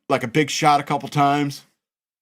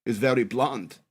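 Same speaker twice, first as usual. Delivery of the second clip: put-on European lady's accent; 2 semitones higher than usual. European lady approaches a kid about a commercial, mock German accent. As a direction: put on a German woman's accent, a touch sing-song. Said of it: "European-sounding lady come and approach me".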